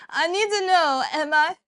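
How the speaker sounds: loud, urgent plea for help; loud pleading. Real, not acted. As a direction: insist loudly on knowing. Begging help with pronunciation.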